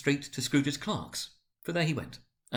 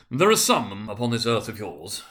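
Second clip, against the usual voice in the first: stern spirit; 6 dB louder than usual. A narrator's reading. Spirit's solemn rebuke.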